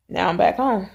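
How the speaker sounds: ordinary talk, nothing marked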